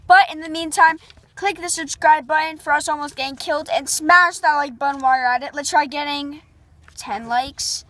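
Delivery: ordinary talk, nothing marked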